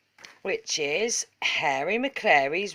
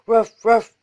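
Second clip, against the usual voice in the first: loud ruff barks; dog bark; 3 semitones higher, 10 dB louder than usual. A narrator's reading. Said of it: "said Muffin McClay".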